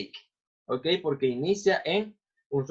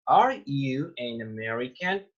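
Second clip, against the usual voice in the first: "Are you an American?" drawn out word by word; slow model reading; slower than usual. Shown as a demonstration. English teacher repeats the question for students, slow model reading.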